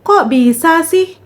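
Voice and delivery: half-hearted retold shout, stretched out; mild mock yell. Reader voicing the friend who ran out of the room, mock yelling. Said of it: "sambil teriak".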